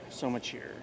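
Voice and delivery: soft echo. Real, not acted. Host quietly repeating himself to viewers. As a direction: drop it to an aside.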